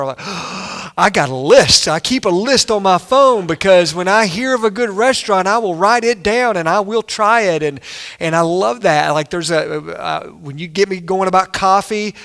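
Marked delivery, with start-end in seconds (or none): 0.2-0.9 sharp, eager intake of breath; excited gasp. Acted. Foodie asked where to eat, excited gasp. Acting out his excited reaction. 1.0-7.6 louder, hammed-up foodie enthusiasm; eager excitement. Foodie answering someone who asks where to eat, excited.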